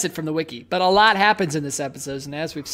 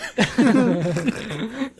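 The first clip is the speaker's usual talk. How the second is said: cartoon laugh; 4 semitones higher than usual.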